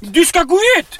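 screaming high orders to get out; mock threat. Driver pretending to hijack the car, shrieking high threats at his passenger. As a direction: bark it like a panicked gunman, very high and loud. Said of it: "Nu hotar jag".

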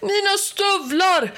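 high outraged yell. A narrator's reading. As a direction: bawl it out at the henchmen. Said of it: "gastade Helgerån".

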